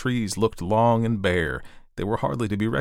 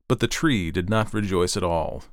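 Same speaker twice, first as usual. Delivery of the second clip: plain reading voice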